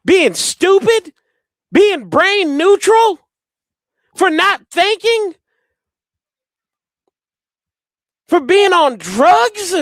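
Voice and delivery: mock the injured player's test, drawn out; mocking questions. Real, not acted. Fan mocking why the returner is being tested, sarcastic.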